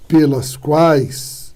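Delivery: "pelas quais" drawn out very slowly; slow correct form. Shown as a demonstration. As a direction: stretch each word. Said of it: "que seria a forma correta".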